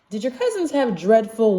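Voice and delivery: usual speaking voice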